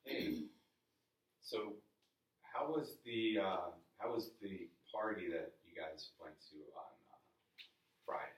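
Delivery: slow, drawn-out small talk; slow-motion chit-chat to show a mismatched pace; talking really slow. Shown as a demonstration. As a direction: drag each word out as if in no hurry. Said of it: "they talk really slow".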